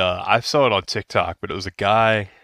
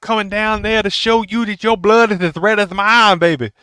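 Dusty Rhodes impression; 12 semitones higher, 7 dB louder than usual. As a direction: cut a promo like a legend on the mic. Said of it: "Dusty's one of my favorite impressions to do".

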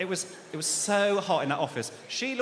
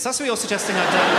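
louder, laughing, "you're" hit hard; 2 semitones higher, 8 dB louder than usual. Retold or imitated. Comedian to the officer, loud laughing indignation. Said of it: "Because if I'm lying".

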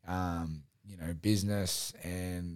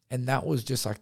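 ordinary talk, nothing marked

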